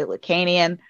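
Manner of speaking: Lacanian said luh-KAY-nee-un; American pronunciation. Shown as a demonstration. Host demonstrating the American way, Lacanian as luh-KAY-nee-un. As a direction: use a long A, as in "cane", in Lacanian. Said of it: "the way that Americans say things".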